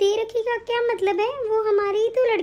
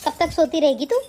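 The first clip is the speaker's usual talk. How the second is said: put-on lower, scolding older woman's voice; mother-in-law bossing her son's wife, lowered scolding voice; nagging mother-in-law; 6 semitones lower than usual. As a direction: berate the bahu like a harsh saas. Scolds bedridden daughter-in-law.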